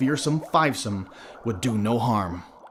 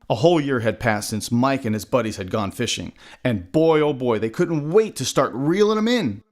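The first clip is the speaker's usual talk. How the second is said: ordinary delivery, nothing marked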